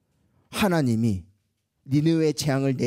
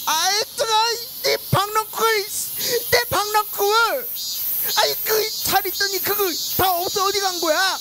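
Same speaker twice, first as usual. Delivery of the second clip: angry tantrum. Acted. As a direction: throw a tantrum over the lost vine. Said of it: "이렇게 막 화를 냈어".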